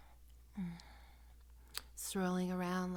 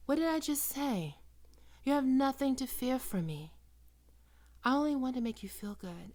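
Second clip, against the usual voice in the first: higher and louder, firm and chiding; stern reassurance; 6 semitones higher, 6 dB louder than usual. Acted. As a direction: snap them out of crying, then reassure. Scolding the crying partner.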